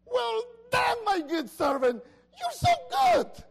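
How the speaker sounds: shouted high and loud with joy; excited exclamation. Shown as a demonstration. Pastor shows the master praising his servant, excited shout. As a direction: burst out with delight, stretching the praise. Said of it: "And that's an exclamation".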